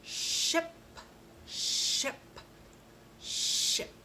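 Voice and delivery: ship with a long unvoiced sh; teacher repeating a word aloud; ship drilled three times. Shown as a demonstration. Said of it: "Let's try that one three times".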